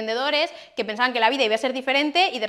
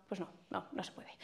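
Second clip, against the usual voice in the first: whispered refusal; 5 semitones lower, 22 dB quieter than usual. Retold or imitated. Telling the client it can't be done, whispered. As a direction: whisper "pues no, no, no se puede".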